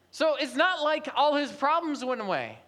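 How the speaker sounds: normal talking voice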